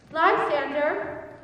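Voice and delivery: high, loud, panicked calls into the dark; frightened calling. Acted. Hermia waking alone, calling for Lysander in fear. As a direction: cry out for him, growing desperate.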